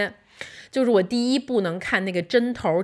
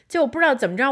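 ordinary delivery

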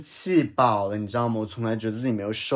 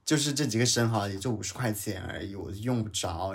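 ordinary delivery

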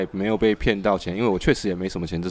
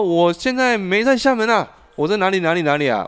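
caller; 9 semitones higher, 3 dB louder than usual. Retold or imitated. Caller's voice in retold call.

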